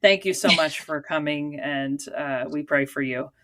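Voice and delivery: ordinary talk, nothing marked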